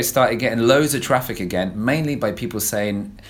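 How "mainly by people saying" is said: ordinary talk, nothing marked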